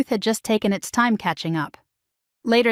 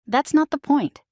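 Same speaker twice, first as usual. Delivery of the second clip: higher-pitched, mildly heated retort; raised voice; 6 semitones higher than usual. A narrator's reading. Ex-husband protesting to his ex-wife on the phone, mildly raised voice. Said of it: "His voice rose enough".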